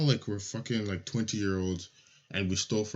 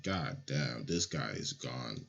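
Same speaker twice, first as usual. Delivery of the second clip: low mock dismay; 8 semitones lower, 6 dB quieter, slower than usual. Kids mocking the boy who peed.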